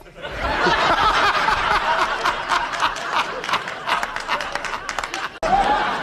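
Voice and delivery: long breathy wheeze of laughter; long wheezing laugh. Real, not acted. Comedian cracking up after the dog punchline, wheezing. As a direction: laugh silently at your own joke.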